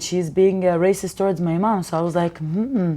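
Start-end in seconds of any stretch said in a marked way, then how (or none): none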